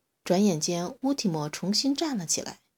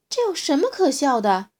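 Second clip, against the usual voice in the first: loud, higher indignant question; angry; 8 semitones higher, 9 dB louder than usual. A narrator's reading. Little dinosaur angrily confronting the laughing T-rex. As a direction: demand an answer, offended. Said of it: "可诺比愤怒了".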